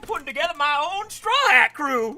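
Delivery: high, loud giddy squeal; excited. Real, not acted. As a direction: burst out with fan joy. Fan delight over One Piece.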